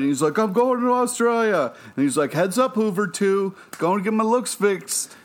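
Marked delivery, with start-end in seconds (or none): 0.4-1.7 high-pitched, excited mock announcement; imitating excitement. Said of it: "then got real excited". 2.3-5.1 high, excited impersonation; imitating excitement. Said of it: "then got real excited".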